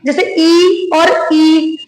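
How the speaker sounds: "ई" said short, then "ई" stretched long, slowly. Shown as a demonstration. Teacher slowly contrasting the vowel lengths for students. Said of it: "ई और बड़ी ई".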